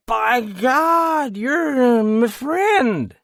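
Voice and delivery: high, loud, slow delighted crow; drunken crowing. A narrator's reading. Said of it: "he crowed".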